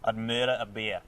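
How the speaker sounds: careful attempt at Australian MURDER A BEER; learner's Australian accent; imitated Australian accent. Shown as a demonstration. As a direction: copy the Aussie phrase back. Copies her Australian phrase.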